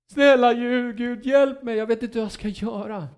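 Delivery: a hint of a frantic believer; pleading prayer. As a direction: beg God for help. Believer praying desperately.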